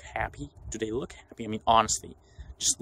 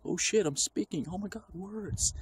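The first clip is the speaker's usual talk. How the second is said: mock panic; 10 semitones higher than usual. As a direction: gasp out each word like speaking is terrifying. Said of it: "It's like".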